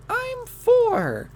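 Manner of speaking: very high put-on small child voice; little girl. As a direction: squeak it like a four-year-old. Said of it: "I saw this little girl".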